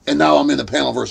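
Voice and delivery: ordinary talk, nothing marked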